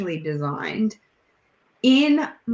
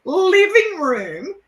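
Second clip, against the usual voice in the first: high-pitched, loud storytelling through laughter; helpless giggly squeal of a retelling; laughing while talking; 10 semitones higher, 5 dB louder than usual. Real, not acted. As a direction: crack up as you tell it, voice shooting up. Telling an embarrassing story, cracking up.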